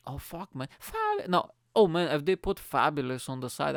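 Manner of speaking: ordinary talk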